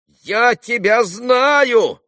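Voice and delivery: very high, loud and stretched out; irritated exclamation. A narrator's reading. Agitated stranger shaking his fist at Kolya, irritated cry. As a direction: wail the accusation slowly, irritated. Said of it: "восклицал он раздражённо".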